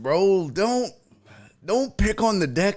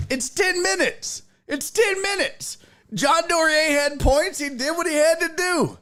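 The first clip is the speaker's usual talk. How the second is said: high-pitched, exasperated argument; comic indignation, pitched way up; mock-exasperated protest; 10 semitones higher than usual. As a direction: plead the case in a worked-up high voice. Mock-outraged defence of a timeout.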